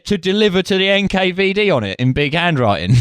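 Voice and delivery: high, hammed-up Cockney pub landlord; British pub landlord. Host imitating a pub landlord returning a spy file, high and hammy. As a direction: bellow it like a cheery London publican. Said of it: "British pub landlord being like".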